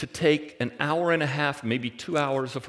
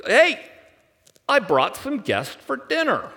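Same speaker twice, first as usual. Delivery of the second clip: high, loud happy call; cheerful announcing; 11 semitones higher, 5 dB louder than usual. Retold or imitated. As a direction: sing it out to your uncle. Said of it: "she tells her Uncle Laban".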